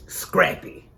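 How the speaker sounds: ordinary delivery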